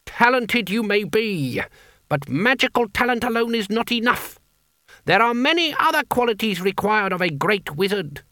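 high-pitched, precise lecturing; indignant old pedant. A narrator's reading. Elderly wizard lectures young Coin, high and admonishing. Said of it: "he snapped".

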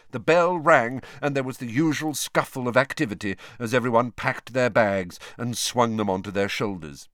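ordinary delivery, nothing marked

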